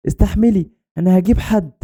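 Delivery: put-on high-pitched, distressed child's reassurance; urgent, panicked promise. A narrator's reading. Girl tells trapped friend help is coming, panicked promise. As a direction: promise rescue in a high, anxious voice.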